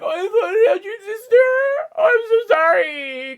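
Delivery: high, loud fake-sorry sarcasm; mock-sorry to the upset kid, hammed up; mock sympathy. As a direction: overplay the fake apology. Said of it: "And I'm like".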